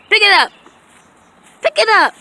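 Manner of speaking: high, loud call to the dog; loud dog command. Real, not acted. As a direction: order the dog to pick it up.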